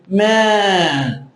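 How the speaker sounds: MAN held very long on its E sound; stretched vowel. Shown as a demonstration. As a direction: draw MAN right out, as if spelt with five Es. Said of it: "Poderia ser mais um E".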